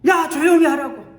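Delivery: high, loud rebuke; scolding hush. Acted. Crowd rebuking the shouting beggar, sharp and loud. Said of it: "많은 사람들이".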